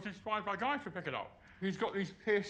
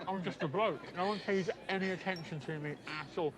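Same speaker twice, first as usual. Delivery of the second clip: ordinary talk, nothing marked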